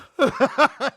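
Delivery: loud high burst of laughter; loud squealing laugh; real laugh. Real, not acted. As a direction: crack up at the joke. Laughing at own bit.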